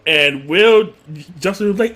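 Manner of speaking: ordinary talk, nothing marked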